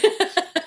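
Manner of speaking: high burst of laughter. Real, not acted. Laughing at dime store joke.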